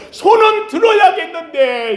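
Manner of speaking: high-pitched, acted urgency; urgent, flustered. Moses must lift his hands while losing, acted urgency. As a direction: play Moses getting flustered, pitched well up. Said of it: "모세가 마음이 다급한 거예요".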